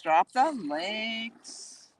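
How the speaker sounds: "Drop the links" stretched out very slowly; drawn-out repeat. Real, not acted. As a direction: linger playfully on each word of "Drop the links". Repeats prompt, drawing it out.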